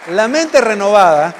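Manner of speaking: building to a forceful pulpit shout; emphatic preaching. Real, not acted. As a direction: drive the key point home to the congregation. Preacher proclaims his key point.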